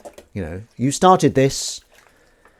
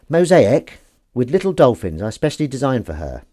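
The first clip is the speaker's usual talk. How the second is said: ordinary talk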